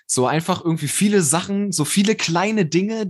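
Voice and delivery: ordinary talk, nothing marked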